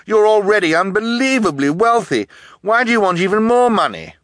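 high, loud, incredulous questioning; indignant challenge. A narrator's reading. Orphan boy challenging greedy guardian, loud and incredulous.

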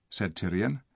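usual speaking voice

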